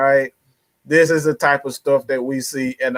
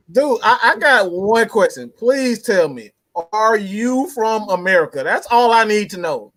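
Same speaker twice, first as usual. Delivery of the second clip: higher-pitched exasperated appeal; baffled questioning; 5 semitones higher than usual. Real, not acted. Co-host questioning the absent fan's sanity, baffled and higher-pitched. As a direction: plead for an explanation in disbelief.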